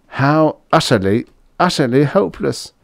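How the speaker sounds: usual speaking voice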